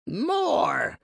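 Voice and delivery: high, slow rasping monster voice; rasping creature. Acted. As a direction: rasp it out like a greedy bird beast. Monster demands more wine.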